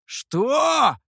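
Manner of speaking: high, loud, stretched exclamation; long incredulous cry, pitched high; drawn-out disbelief. A narrator's reading. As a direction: drag the word out in shock. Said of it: "протянул демон".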